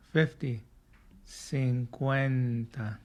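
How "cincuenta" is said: very slow cuarenta, every syllable drawn out; slow model word. Shown as a demonstration. Teacher drills cuarenta for students, very slowly. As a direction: stretch cuarenta out for learners to copy. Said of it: "Say it with me".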